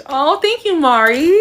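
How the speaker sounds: high-pitched, touched thank-you; gushing thanks. Real, not acted. Streamer thanking a viewer for a compliment, high and gushing. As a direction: lift the voice way up in sweet gratitude.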